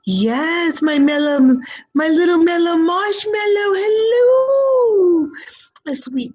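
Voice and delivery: very high, loud cooing at a sweet viewer; high squeaky marshmallow baby voice; cooing baby talk. Acted. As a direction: coo like to a puppy. Cooing pet names at a viewer.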